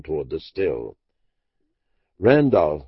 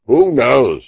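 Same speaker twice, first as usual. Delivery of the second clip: louder, despairing cry of Randolph; cried out; 5 semitones higher, 9 dB louder than usual. Acted. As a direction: fling the question out, helpless and loud. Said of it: "he cried".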